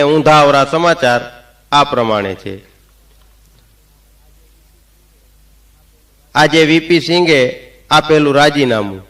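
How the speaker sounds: formal, measured news announcer read; radio newsreader. Pretend. Comedian opening a mock radio bulletin for the audience, announcer voice. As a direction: announce it like All India Radio.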